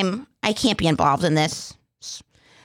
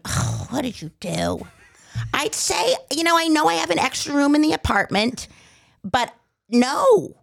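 impression of mom; 8 semitones higher than usual. Son imitating his mom dodging the question, high and mocking. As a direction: mimic mom brushing off her son. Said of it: "I just love the impression of".

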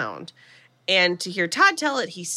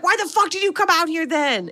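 higher-pitched indignant retelling of an outburst; mock furious; 8 semitones higher than usual. Eddie snapping at Karen after she pushes him away, mock furious. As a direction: imitate an angry demand, pitched up. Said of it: "He's immediately furious".

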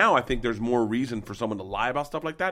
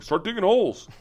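high, louder mock command; mock boss order; 10 semitones higher, 3 dB louder than usual. Imagined boss giving a digging order, high mock command. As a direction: bark it like a careless boss.

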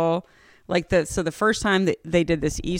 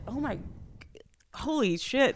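hushed, higher-pitched amazement; astonished under the breath; stunned awe; 6 semitones higher, 7 dB quieter than usual. Retold or imitated. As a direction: recall being blown away, softly. Stunned reaction to an ending.

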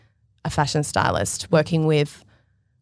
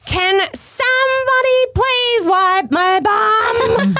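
put-on drawn-out, very high kid's yell; screaming child; 4 dB louder, much slower than usual. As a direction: imitate a child hollering slowly from another room. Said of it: "repeatedly hear Cuba screaming".

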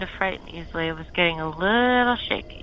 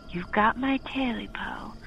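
menacing whisper; 3 semitones higher, 6 dB quieter than usual. Acted. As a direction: breathe it right into his face. Creature on his chest speaks.

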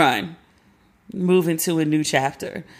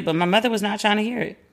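usual speaking voice

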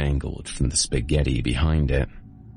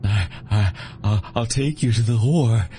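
begging; 7 semitones higher than usual. Acted. Copperhead begs RJ to let him live, high and stammering. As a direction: beg for your life, stumbling.

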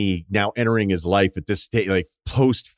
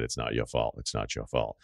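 soft, low, lightly imitated Boston accent; put-on Boston accent; 10 semitones lower, 12 dB quieter than usual. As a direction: quietly drop the R's, mock Boston. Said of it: "I did it in the accent".

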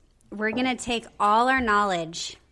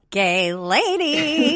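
drawn out; 6 semitones higher, much slower than usual. Acted. Savoring the phrase slowly.

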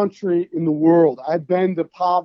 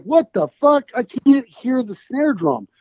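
higher-pitched, exasperated retold outburst; exasperated disbelief; 5 semitones higher than usual. Singer recalling crowd drowning out the snare drum, exasperated. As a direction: act out being baffled and fed up. Retells frustration at deafening crowd.

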